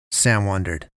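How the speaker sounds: ordinary delivery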